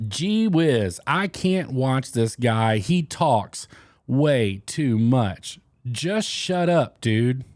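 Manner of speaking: slow, drawn-out fed-up comment reading; mock exasperation. Vlogger reads a comment about talking too much, mock exasperated. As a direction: drag out each word like a weary complainer.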